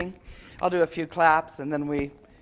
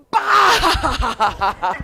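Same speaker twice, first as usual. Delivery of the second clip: sudden loud laughing bah; laughing burst; 5 semitones higher, 8 dB louder than usual. Shown as a demonstration. Teacher demonstrates releasing held breath with a laugh. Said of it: "and then it's gonna go".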